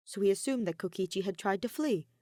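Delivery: usual speaking voice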